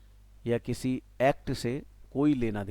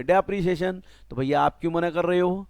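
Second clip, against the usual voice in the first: mock courtroom retort; 6 semitones higher, 6 dB louder than usual. Teacher acts out class exchange.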